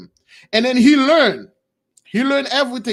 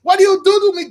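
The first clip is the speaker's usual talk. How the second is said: high, loud, panicked wail to God; the bitten monkey cries out to God, frantic; crying out in fear; 9 semitones higher, 4 dB louder than usual. Retold or imitated. A monkey chased by a pit bull cries out to God, high and loud. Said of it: "the monkey was crying".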